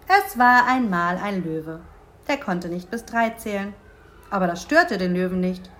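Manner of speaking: put-on measured storyteller reading to children; deliberate fairy-tale telling with pauses; story narration. A narrator's reading. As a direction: read a picture book aloud, taking your time. Reads lion story aloud to children.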